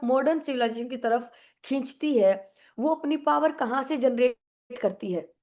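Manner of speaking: ordinary talk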